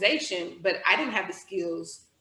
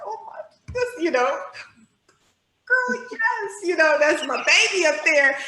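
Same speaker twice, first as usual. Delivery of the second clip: high, excited squeal of pride; proud mom gush; 8 semitones higher, 5 dB louder than usual. Retold or imitated. Mother watching her child onstage, high proud gushing. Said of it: "I'm looking up there like".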